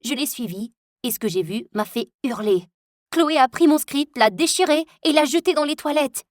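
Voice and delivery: usual speaking voice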